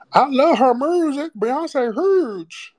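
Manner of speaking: very high-pitched, exaggerated Memphis accent; loud squealed caricature of a thick Memphis accent; mock a heavy Memphis accent way up high. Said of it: "that was just beyond an accent".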